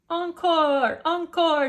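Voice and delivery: excited encore cries; delighted cry. Acted. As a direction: cheer for an encore. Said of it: "she cried for more".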